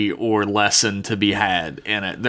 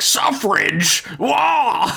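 put-on high, squawking outraged old doctor; McCoy impression; 11 semitones higher, much slower than usual. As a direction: blurt it like a flustered McCoy. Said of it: "Doctor McCoy would have been like".

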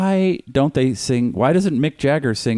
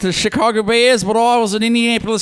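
high-pitched mock Aussie; hammed-up Australian voice; horrible Australian accent; 10 semitones higher than usual. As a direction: keep the bad Australian going, pitched way up. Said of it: "I do a horrible Australian accent".

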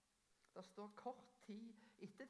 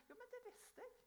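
glad reply. Retold or imitated. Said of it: "jeg kan si".

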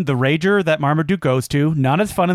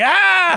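mock panic yelp; 13 semitones higher, 3 dB louder than usual. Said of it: "I'd be like".

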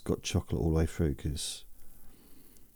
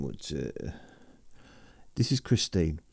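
normal talking voice

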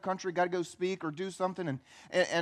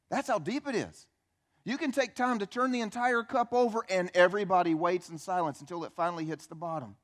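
ordinary talk, nothing marked

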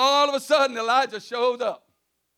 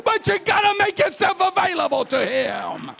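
shouted preaching; 5 semitones higher than usual. Acted. Preacher urges the congregation to make themselves available, shouting. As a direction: shout it to the back row. Shouted, then repeated quietly.

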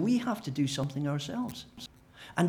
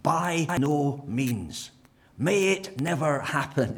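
"By no means" very slow, louder, each word weighted; emphatic; 3 semitones higher, 5 dB louder, much slower than usual. Acted. Paul's emphatic Greek denial, slow and loud. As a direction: drag out "By no means" with force. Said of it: "in the most emphatic way".

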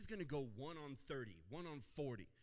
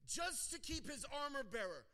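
ordinary delivery

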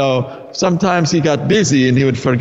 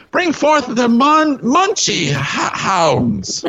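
villain voice; 7 semitones higher, slower than usual. Acted. Villain summons his hounds.

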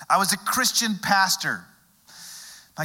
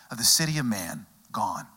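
hushed; 7 semitones lower, 4 dB quieter than usual. Acted. Hushed preaching climax.

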